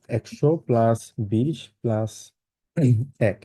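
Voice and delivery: slow, spelled-out breakdown for students. Shown as a demonstration. Teacher breaking the number into parts slowly. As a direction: lay out each part slowly. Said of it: "কিভাবে বসবো?".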